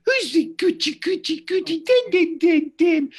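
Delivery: put-on very high, squeaky cooing baby talk; mock baby talk. Shown as a demonstration. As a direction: coo at it like a silly baby voice. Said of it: "is baby talking".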